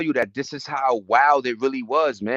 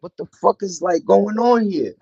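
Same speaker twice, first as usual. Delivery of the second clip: retold bewilderment; 7 semitones higher than usual. Retells bewildered reaction to drug trend.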